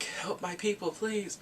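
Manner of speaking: retold soldier's soft entreaty; imitated plea. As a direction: plead gently, voice lifted. Soldier begging the general for help.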